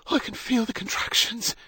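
high, strained gasp. A narrator's reading. Wife feeling the first contractions, gasping. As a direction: gasp it out through the pain. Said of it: "she gasped".

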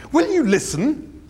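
high, slow, exasperated snap; snapped. A narrator's reading. Ford losing patience with Arthur, snapping high. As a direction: bite it off, fed up and high. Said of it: "he snapped".

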